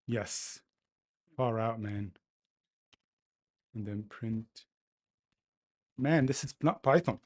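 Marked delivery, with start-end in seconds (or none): 6.0-7.2 loud irritated complaint; frustrated outburst. Real, not acted. Angry coder complains the language isn't Python, loudly. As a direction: vent at the language. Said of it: "I'm getting really angry".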